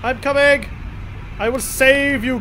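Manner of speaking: heroic rescue cry. Pretend. Rushing to rescue the ship.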